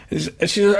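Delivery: usual speaking voice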